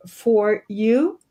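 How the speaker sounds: ordinary talk, nothing marked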